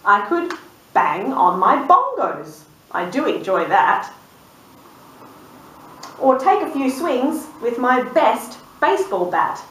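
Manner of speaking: bouncy rhyming read; reading to children. A narrator's reading. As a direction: read the list like a playful rhyme. Reading sheep's rhyming wishes.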